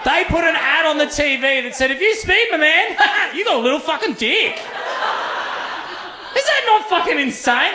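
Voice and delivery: high, laughing through the words; laughing at the absurd ad. Real, not acted. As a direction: crack up while telling it. Laughing while retelling.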